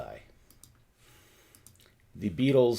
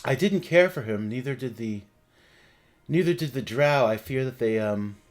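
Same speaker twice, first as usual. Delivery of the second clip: lightly higher ghost voice; gentle spirit reassuring them; ghostly voice; 3 semitones higher, 6 dB louder than usual. Acted.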